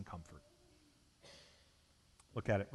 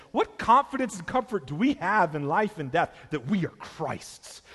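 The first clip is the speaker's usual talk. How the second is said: high, loud building preacher's refrain; rising sermon; 5 semitones higher, 4 dB louder than usual. Acted. Preacher building to his congregation, ringing. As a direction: preach it harder with each line.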